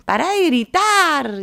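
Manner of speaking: high, drawn-out shout. Acted. One arguer to the other mid-conversation, acted shouting. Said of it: "alzando la voz, uno dice".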